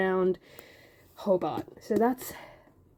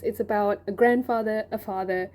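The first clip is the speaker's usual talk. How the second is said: normal talking voice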